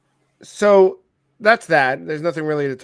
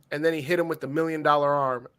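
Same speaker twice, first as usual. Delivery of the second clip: usual speaking voice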